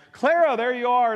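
high, loud burst of relief; relieved cry. Retold or imitated. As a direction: call out her name like a weight has lifted. Wife finds missing daughter.

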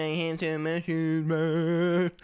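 drawn-out, cartoonish stoner monotone. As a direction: drag it out like a comically dazed stoner. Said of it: "talking like a stereotypical stoner from L A".

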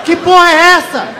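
shocked outburst. Retold or imitated. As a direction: blurt it out, stunned and outraged. Comic shocked outburst after smoke. (grito chocado e esticado)

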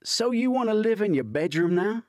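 high, loud mocking question; teasing. A narrator's reading. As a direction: needle him playfully. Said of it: "Hunter teases".

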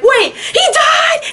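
high, slow cry of disbelief; shocked realization. Retold or imitated. Fan realizing on rewatch that Strange died, high shocked cry. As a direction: gasp it out as it suddenly hits you.

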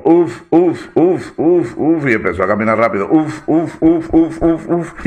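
mock puffing uf sounds; panting camel. Camel panting at the rider, loud puffing. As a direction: puff it out like a tired camel.

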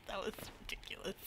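fast unvoiced whisper. Real, not acted. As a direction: whisper it quickly. Whispered aside.

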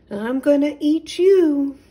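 high, loud wailing cry; a tearful cat who must eat the mole; sobbing threat. Acted. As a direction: wail it out through tears. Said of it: "sobbed Katundra".

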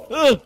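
high, loud yelp of alarm; short sharp "ugh" on being hit; real jolted cry; startled grunt. Real, not acted. Hit by creepers in game.

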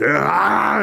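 imitated angry demon growl-scream; mad demon roar. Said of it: "this demon gets mad".

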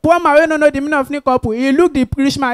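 ordinary delivery

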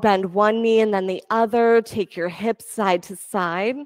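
calm, steady instructor cues; yoga class cueing. Acted. Yoga teacher guiding students through poses, calm cueing. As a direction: lead the class through each pose gently.

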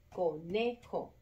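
"conejo" slowly split, co-NE-jo; stress on NE. Shown as a demonstration. Teacher showing the tonic syllable, "conejo" split with NE stressed. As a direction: separate the beats of "conejo", lean on NE. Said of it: "la sílaba tónica".

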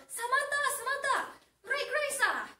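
high, loud shout calling someone back; urgent parental holler; acted yell. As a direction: yell after a departing car as an alarmed dad. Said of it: "So what does the dad yell?".

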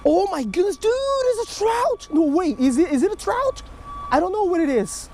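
high, thrilled squeals at the catch; can't believe it's a trout; real excitement. Real, not acted. Angler thinks he hooked a trout, high excited squeal. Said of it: "I was excited for nothing".

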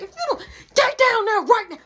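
high, loud furious mom yell; mother yelling at her son to get down; angry mom. Retold or imitated. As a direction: scream it at the kid like a mad mother. Angry mom grabs him.

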